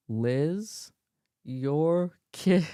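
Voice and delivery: low, booming dad call; famous dad line. Retold or imitated. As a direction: boom it like a fed-up dad. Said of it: "he gave the famous".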